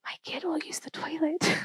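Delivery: comic whisper, barely voiced; hushed, hopeful whisper in mock prayer; whispered plea. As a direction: whisper it like a desperate secret hope.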